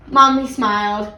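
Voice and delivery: ordinary delivery, nothing marked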